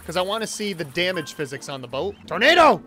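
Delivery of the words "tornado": loud, high warning shout; alarmed shout; calling out. Real, not acted. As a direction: yell it out as the tornado appears. Spots a tornado in game.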